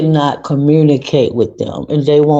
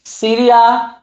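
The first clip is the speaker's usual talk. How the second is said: higher-pitched call to someone nearby; 8 semitones higher than usual. Real, not acted. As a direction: call a name out, pitched well up. Calls someone off-mic mid-talk.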